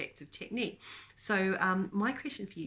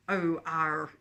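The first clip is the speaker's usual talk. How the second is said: louder, hammed-up mock pirate; a quick, badly faked pirate arr; old country pirate; 5 dB louder than usual. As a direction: throw in a jokey, louder pirate growl. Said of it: "some sort of old country pirate".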